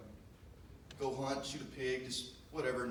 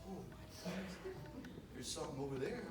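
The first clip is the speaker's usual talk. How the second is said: hushed excitement; 6 semitones higher, 7 dB quieter than usual. Retold or imitated. Hunter spots movement, softly amazed. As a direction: breathe it out in amazement. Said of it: "I'm like".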